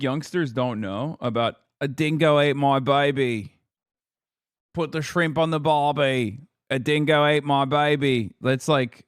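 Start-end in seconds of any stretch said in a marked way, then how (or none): 1.8-8.3 put-on quoted catchphrases in a light Aussie accent; lightly put-on Australian twang; mock Australian accent. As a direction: imitate the old Aussie cliché. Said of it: "dingo joke".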